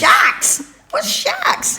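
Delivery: "Sharks" as a flat-vowelled "shacks"; mimicked "sharks". Nanny querying the accent, "Sharks" said as "shacks". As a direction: echo "Sharks" as "shacks", puzzled. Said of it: "They don't pronounce their R's".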